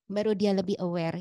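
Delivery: ordinary talk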